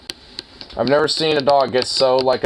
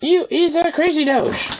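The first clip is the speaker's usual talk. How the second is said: squeaky dog talk. Real, not acted. Teasing the dog.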